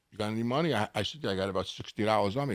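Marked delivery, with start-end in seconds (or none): 0.1-0.7 hushed aside. Retold or imitated. Buddy leans over at the check. 1.2-2.5 low, quick, quiet answer; quiet reply. Retold or imitated. Young man answering his friend, low and quick.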